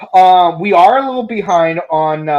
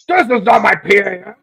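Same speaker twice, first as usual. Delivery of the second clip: villain voice; 7 semitones higher than usual. Retold or imitated. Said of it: "I never tried this character".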